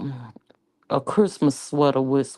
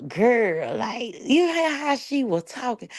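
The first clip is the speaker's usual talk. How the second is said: quoting a friend; 10 semitones higher than usual. Retold or imitated. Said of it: "they were like".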